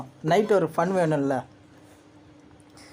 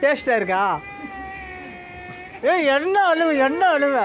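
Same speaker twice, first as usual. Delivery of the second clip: raised, high teasing scold; mocking challenge; 8 semitones higher than usual. Real, not acted. Vlogger needles the little girl for crying, mocking. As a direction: pick on the crier, pitched up.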